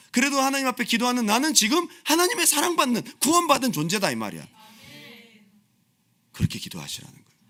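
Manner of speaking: ordinary talk, nothing marked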